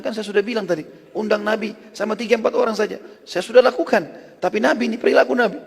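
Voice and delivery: high, insistent back-and-forth; imitated domestic dispute, pitched right up; acted protesting. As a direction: argue it out like a rattled couple. Acted husband-wife dispute over the invitation.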